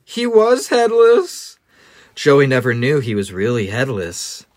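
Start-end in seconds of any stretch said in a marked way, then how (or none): 0.1-1.6 higher, slow, louder reveal; dramatic reveal. A narrator's reading. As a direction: drag out the shock. Dramatic story reveal again.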